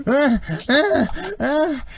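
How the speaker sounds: short high acted screams; repeated loud, high cries of agony; screaming in pain. Tortured rebel as his mouth tears, high pained screams. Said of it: "the guy's just going".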